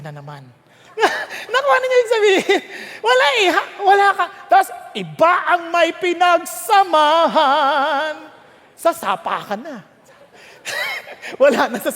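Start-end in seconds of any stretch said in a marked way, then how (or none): 1.0-1.5 a short breathy burst of laughter. Real, not acted. Preacher laughs at his own diabetes joke, short breathy laugh. 10.6-11.4 a sudden high-pitched giggle. Real, not acted. Preacher giggles at his own joke, high and quick.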